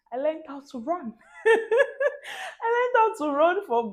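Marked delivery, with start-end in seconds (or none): 1.4-2.6 high, crying laugh. Real, not acted. Laughing at own story.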